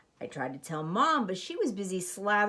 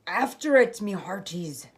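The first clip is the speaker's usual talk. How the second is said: lightly voiced, louder pirate order; pirate command; 5 dB louder than usual. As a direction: send the crew after the ball. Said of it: "Braidbeard commanded".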